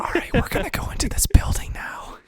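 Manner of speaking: breathy gamer whisper. Pretend. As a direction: whisper it like ASMR. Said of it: "you just going".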